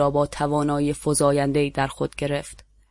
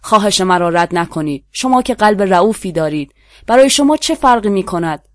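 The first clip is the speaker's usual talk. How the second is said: loud, higher and higher stirring plea; excited cry; 4 semitones higher, 6 dB louder than usual. A narrator's reading. The prisoner leans over the balcony, crying out to stir the crowd. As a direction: whip up the crowd with a rising shout. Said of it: "با صدایی مهیج فریاد زدم".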